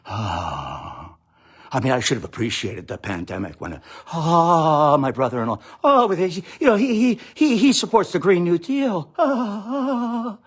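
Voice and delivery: mock-exasperated friend griping about relatives; hammed-up holiday complainer; whining complainer. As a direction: groan it out like a fed-up houseguest. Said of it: "your friends who are saying".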